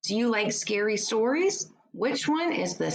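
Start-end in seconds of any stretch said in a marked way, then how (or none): none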